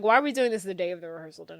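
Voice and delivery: usual speaking voice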